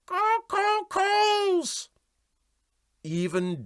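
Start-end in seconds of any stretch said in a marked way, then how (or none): 0.0-1.9 very high squawking caw; high cockatoo screech; raucous bird cry. A narrator's reading. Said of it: "a raucous voice from the uncovered hold cried".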